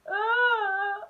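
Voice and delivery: high, loud imitated wail; a kid wailing on the run; mock wail. Kid running home from the snake, mock wailing.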